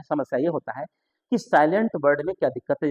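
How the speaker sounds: ordinary talk, nothing marked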